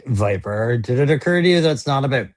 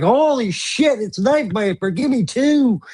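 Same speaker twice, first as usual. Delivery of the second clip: mock amazed; 9 semitones higher than usual. Imagined buyer suddenly sold on the figure, amazed and high-pitched. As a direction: gush like a won-over buyer. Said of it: "You're like".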